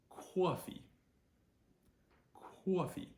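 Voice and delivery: high, rounded New Jersey "cwaw-fee"; New Jersey accent. Shown as a demonstration. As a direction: put a W into COFFEE, New Jersey style. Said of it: "But in New Jersey accent".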